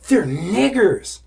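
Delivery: high, loud, drawn-out protest; mock a man yelling in disbelief; imitated outraged insistence. Man insisting in acted quarrel.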